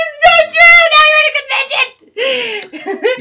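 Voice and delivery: high, loud laughing quote. Retold or imitated. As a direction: squeal it through laughter. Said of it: "I was like".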